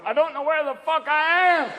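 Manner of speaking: high-pitched stoned horse; talking horse. Acted. Cowboy's stoned horse complaining of cotton mouth, high horse voice. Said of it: "Even the horse will be going".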